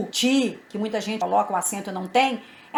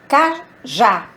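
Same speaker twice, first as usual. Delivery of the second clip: "cajá" split, with JÁ hit hard; stress on last syllable; 6 dB louder than usual. Shown as a demonstration. Teacher showing the strong last syllable, "cajá" stressed on JÁ. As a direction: punch the final JÁ of "cajá" after a tiny break. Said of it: "Já é a última sílaba pronunciada".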